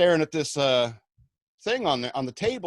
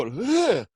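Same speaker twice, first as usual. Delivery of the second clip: disgusted ugh; 8 semitones higher, 5 dB louder than usual. Retold or imitated. Recoils as guy touches the bud.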